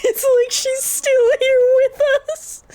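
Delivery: high, slow laughing wail. Real, not acted. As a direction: laugh it out, slowly. Laughing about lost friend.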